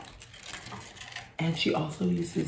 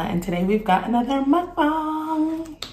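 excited; 10 semitones higher, 9 dB louder, slower than usual. Real, not acted. Excited intro, mukbang announcement.

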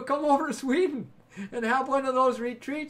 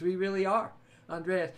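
ordinary talk

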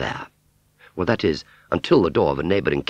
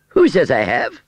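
high, loud, affronted denial; haughty fairground aunt; 10 semitones higher, 7 dB louder than usual. Acted. Aunt Sally denying she ate an apple, haughty. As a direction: deny it indignantly, pitched well up.

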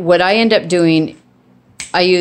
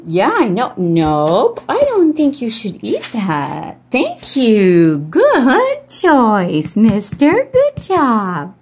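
dog praise voice; 4 semitones higher, much slower than usual. Real, not acted. Talking to the dog.

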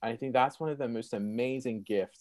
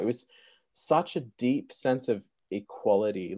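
ordinary talk

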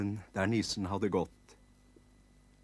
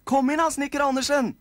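high, loud squeaky call; eager nisse kids; 10 dB louder, faster than usual. Acted. Kids inviting carpenter Andersen inside, squeaky shout. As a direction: call him in like tiny excited elves.

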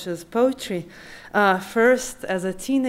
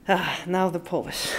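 ordinary delivery

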